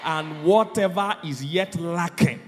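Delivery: rising into a full preacher's shout; building to shout. Real, not acted. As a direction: build from firm to a ringing decree. Pastor decrees blessing, shouts.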